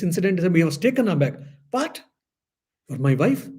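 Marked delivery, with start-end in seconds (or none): 1.7-3.5 shocked disbelief. Retold or imitated. Husband shocked by wife's words.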